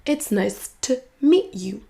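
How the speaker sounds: slow, "to" cut down to a weak t; reduced TO. Shown as a demonstration. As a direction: separate the words slowly, "to" as a tiny t. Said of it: "Le to va se réduire en t". (lentement, to réduit en t)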